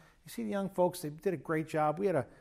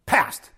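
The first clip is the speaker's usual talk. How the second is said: "Past." punched out louder; emphatic single word; 7 dB louder than usual. Shown as a demonstration. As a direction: hit "Past." hard and short to show it's gone. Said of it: "See, watch this".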